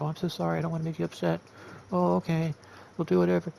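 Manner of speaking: high, mock-sweet people-pleaser voice; hammed-up groveling to keep everyone happy; kissy-butt groveling. Mimicking staff groveling to upset members in a mock-sweet voice. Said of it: "a little kissy butt".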